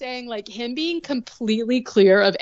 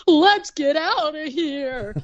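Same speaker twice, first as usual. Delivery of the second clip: slow, louder, pitched-up grumpy dad; hammed-up fed-up father; gruff dad; 7 semitones higher, 4 dB louder, slower than usual. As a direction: hustle the kid away like an annoyed dad. Dad hurrying son away.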